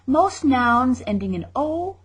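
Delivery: ordinary talk, nothing marked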